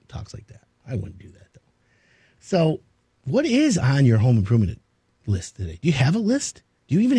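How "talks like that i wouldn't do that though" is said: muttered aside. Real, not acted. Muttered aside after a radio joke.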